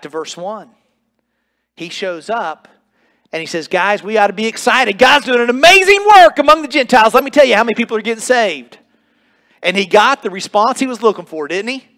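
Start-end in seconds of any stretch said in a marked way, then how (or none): 3.7-8.8 much higher and louder, bursting with good news; excited Peter. Acted. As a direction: rush it out thrilled, faster at the end.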